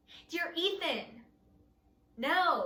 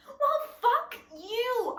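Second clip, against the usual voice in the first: angry retort; 8 semitones higher, 3 dB louder, slower than usual. Acted. Angry outburst at Avery.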